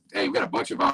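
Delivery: high-pitched, garbled audio; helium voice. Real, not acted. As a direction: sounds like a chipmunk on a bad line. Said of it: "You sound like you've been sucking helium".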